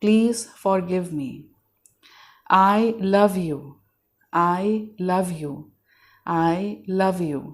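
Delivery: very slow, gently drawn-out prayer; meditative affirmation. Shown as a demonstration. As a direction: let each phrase stretch out calmly. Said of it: "आंखें बंद करके in the moment आके". (बहुत धीमी, शांत प्रार्थना की लय)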